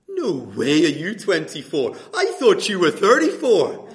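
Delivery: loud, incredulous Northern Irish impression; Northern Irish accent. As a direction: blurt it out in a not-so-great Northern Irish accent. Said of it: "I am gonna do the accent".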